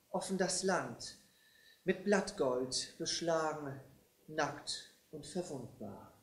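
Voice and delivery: measured, reverent verse delivery; poem recitation. Acted. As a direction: recite solemnly, fading to a hush at the end.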